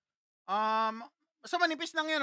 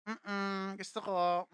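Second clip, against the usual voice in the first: put-on soft, lower, longing little-boy murmur; shy craving; 4 semitones lower, 8 dB quieter, much slower than usual. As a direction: quietly beg for the sweet. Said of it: "Sabi ko, Mami". (mahinang paghahangad)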